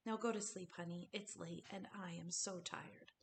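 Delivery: fading to a hushed, breathy whisper; tired mother whispering her son to sleep; sleepy whisper. A narrator's reading. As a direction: drift off as you murmur it.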